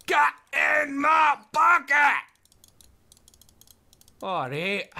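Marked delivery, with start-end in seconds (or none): 0.1-2.2 slow, high, loud order; forceful command. Real, not acted. Player ordering it into his bucket, forceful shouting. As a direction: shout each word slowly at the stubborn thing. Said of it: "talking to it like that".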